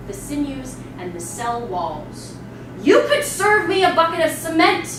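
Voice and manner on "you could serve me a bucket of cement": louder, higher mocking scorn; scornful outburst. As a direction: tear into the cook's chicken. Mistress berates cook.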